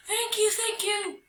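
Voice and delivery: very high-pitched character voices; grateful calling. Acted. Creatures thanking the departing spirit, calling out high. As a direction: squeak out eager thanks. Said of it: "called the creatures".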